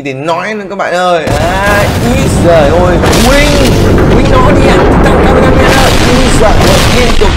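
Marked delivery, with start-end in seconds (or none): 2.1-7.3 cheering shout. Real, not acted. Cheering on Titan Cameraman.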